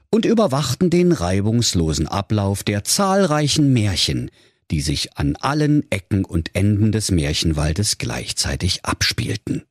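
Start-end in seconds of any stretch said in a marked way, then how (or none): none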